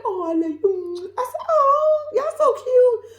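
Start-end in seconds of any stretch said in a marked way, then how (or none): 0.0-1.1 high, drawn-out gushing; syrupy mock sweet talk; mock lovey-dovey. Mimicking the couple's lovey talk. 1.5-3.1 very high, loud squeal of adoration; squeaky, over-the-top aww; gushing. Acted. Gushing over the cute couple.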